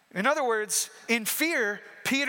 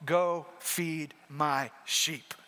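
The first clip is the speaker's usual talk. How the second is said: slow tender charge; 7 semitones lower, 5 dB quieter, much slower than usual. Acted. Jesus gives Peter his calling.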